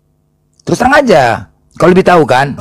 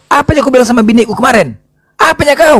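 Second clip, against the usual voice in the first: demanding driver; 9 semitones higher than usual. Acted. Driver presses the gossip seller.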